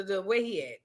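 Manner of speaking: usual speaking voice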